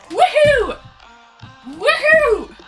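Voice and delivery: high, loud cheering woohoo; excited woohoo. Real, not acted. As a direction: cheer like a fan. Celebrating the raid.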